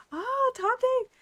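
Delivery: a sudden high, loud cry of recognition; excited recognition. Real, not acted. Gamer reacting to the old man's story with an excited cry. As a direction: leaps up as the name clicks.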